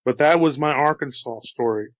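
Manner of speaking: usual speaking voice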